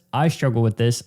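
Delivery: ordinary delivery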